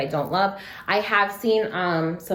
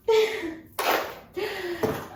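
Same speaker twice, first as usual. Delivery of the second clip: high rueful laugh; 12 semitones higher than usual. Real, not acted. Laughing at her own pain.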